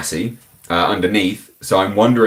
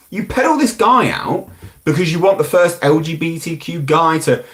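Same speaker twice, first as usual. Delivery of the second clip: ordinary talk, nothing marked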